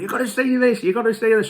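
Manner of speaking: put-on high, eager call of an excited child; a son bursting in, keen to show the advert; excited kid. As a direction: rush in, desperate to show dad something. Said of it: "And Charlie comes running".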